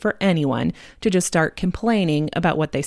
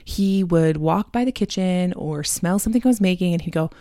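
normal talking voice

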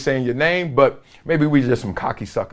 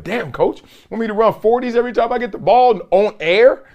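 mock complaint; 8 semitones higher than usual. Player complaining to coach.

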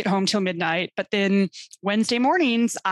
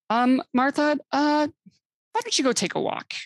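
higher, hesitant boss voice; 6 semitones higher than usual. Retold or imitated. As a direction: play the awkward boss sending her off. Imitating old-school boss.

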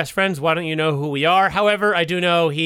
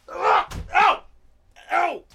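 mock pain yelps; 12 semitones higher, slower than usual. Acting painful somersaults.

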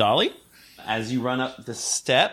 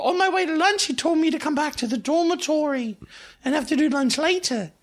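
high-pitched schoolgirl; Harriet; 13 semitones higher than usual. Acted. Harriet explains her trip to lunch, high-pitched and chatty. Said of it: "I'm, I'm Harriet".

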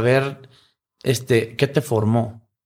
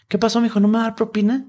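higher waitress voice; 9 semitones higher, faster than usual. Retold or imitated. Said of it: "me dice la señorita".

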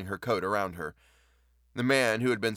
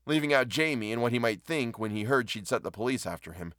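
ordinary delivery, nothing marked

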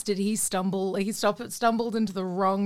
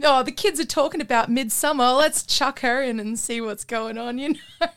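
higher, louder, hammed-up studio enthusiasm; mock producer pitch; 5 semitones higher, 5 dB louder than usual. Producer chasing what kids talk about, mock eager. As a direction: imitate a producer jumping on a trend. Mimics out-of-touch producer casting an actress.

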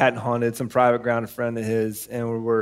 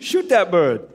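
put-on Maine accent; 5 semitones higher, slower than usual. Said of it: "Do it".

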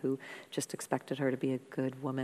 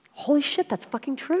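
surprised; 10 semitones higher, 6 dB louder than usual. Retold or imitated. Sudden realisation retold.

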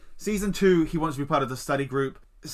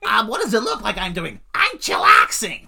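high nasal nerd voice, laughing; 6 semitones higher, 8 dB louder than usual. Retold or imitated. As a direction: squeak it out through the nose. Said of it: "a really nasally voice".

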